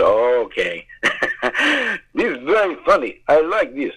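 put-on a light Russian accent, officials amused then scolding; half-playful Russian officials; mock Russian accent. Russian officials scolding the visiting actor, in a mock Russian accent. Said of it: "really bad Russian accents that sound like German".

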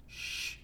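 soft "shh"; hushing shush. Acted. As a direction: hush someone down. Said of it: "didn't want him to say nothing".

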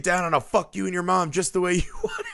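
high, fast, hammed-up rant at no great volume; mock angry threat. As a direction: imitate a man losing it at a locked door. Acting character banging at door.

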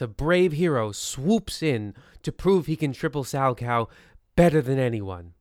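ordinary delivery

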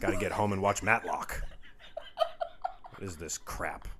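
mock grumbling, a hint of irritation; pissed-off husband. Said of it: "He was pissed".

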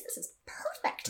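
whispered inner thought; gleeful whisper. Retold or imitated. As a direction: whisper it like a secret triumph.

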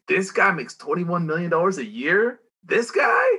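disbelieving voice, rising to a squeak; incredulous. Retold or imitated. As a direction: scoff at the salary in disbelief.

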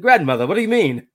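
put-on high, loud prim British-style child voice; proper English kid. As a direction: ham up a posh little English boy. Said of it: "Who is this English".

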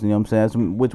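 normal talking voice

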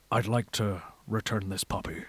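low, soft, deadpan menace; deadpan threat about a puppy; Liam Neeson impression. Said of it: "bit of stand-up I used to go".

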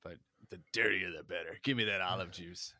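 ordinary delivery

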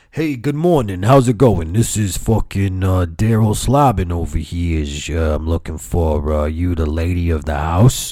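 sinking into a low disguised voice; crazy put-on caller. Telemarketer asking for the lady of the house, crazy low voice. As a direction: play a bogus telemarketer in an altered voice. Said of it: "I'm gonna get crazier with my voices".

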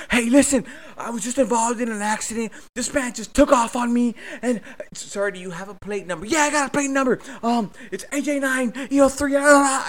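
high, loud, rushed caller voice; agitated report. Retold or imitated. Caller to dispatcher, breathless and agitated. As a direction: pant out the plate number.

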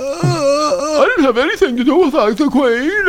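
high silly royal voice, laughing at times; a dim prince fumbling excuses; mock Prince Andrew. Host plays Prince Andrew denying everything in a high voice.

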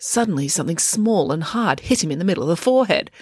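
usual speaking voice

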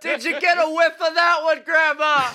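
high, very loud comic holler; shouting at grandma. Pretend. Co-host teasing the deaf grandma, mock holler. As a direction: holler it to a hard-of-hearing granny.

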